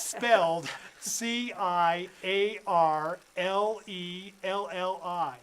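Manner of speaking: slow, careful letter by letter; slow spelling. Shown as a demonstration. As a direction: spell each letter slowly. Spelling name slowly.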